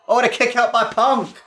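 high, surprised excited call. Real, not acted. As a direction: react to the kick out.

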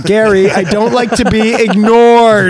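loud, high mock-outraged email voice; mock stern email. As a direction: bellow it like an offended customer. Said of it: "strongly worded email".

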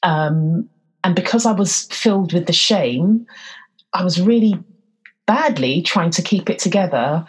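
ordinary delivery

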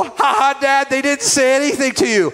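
mock the dad, laughing through it; kids teasing. Kids teasing their dad about ignored waves, laughing taunt.

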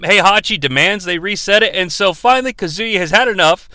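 ordinary delivery

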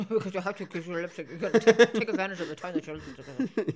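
fast, laughing, lips barely moving; stiff-lipped duck talk. Shown as a demonstration. Explaining to a friend why duck talk is fast, laughing. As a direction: rush the words out through frozen lips. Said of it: "talking faster like this".

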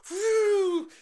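high, loud "woo"; gleeful flying whoop. Pretend. Man imagining his body launched by trebuchet, gleeful whoop. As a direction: whoop like being flung through the sky.

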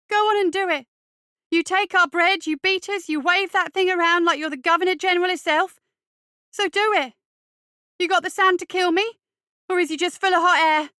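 high, loud challenge to a bully; shouted, goading tirade; reckless fury. A narrator's reading. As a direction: let the indignation pour out, pitched right up. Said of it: "Radley continued recklessly".

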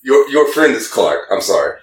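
ordinary talk, nothing marked

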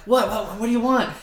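high, loud, stammered mock alarm; imitated startled reaction. Dad imitating his kids startled awake in the car, mock alarm. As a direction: act out being jolted from sleep. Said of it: "it would startle everybody".

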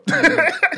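sudden high shriek of laughter; burst of laughter. Real, not acted. Laughing hard at her own mishap, a high loud burst of laughter.

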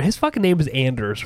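"Anders" starting like "and"; Anders with flat A. Shown as a demonstration. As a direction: say "Anders" with the vowel of "cat". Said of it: "Yes, or Anders".

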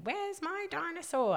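lightly voiced small child; toddler voice. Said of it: "running around going".

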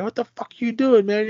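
high-pitched mock outrage; alarmed scolding. Gang to kid lighting a cigarette, high mock scolding. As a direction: imitate a startled telling-off, pitch way up.

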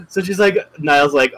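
ordinary delivery

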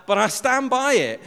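loud, high-pitched defiant repeat; emphatic insistence. Real, not acted. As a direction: raise the voice and insist, standing your ground. Emphatic repeat of his accusation.

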